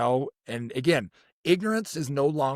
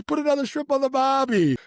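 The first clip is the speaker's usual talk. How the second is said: high, hammed-up Aussie; goofy high-pitched Australian impression; bad Australian accent; 10 semitones higher, 3 dB louder than usual. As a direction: do a terrible movie-quote Australian accent. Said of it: "Dumb and Dumber".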